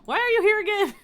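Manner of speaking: stern outburst. Acted. King scolds returning prisoner.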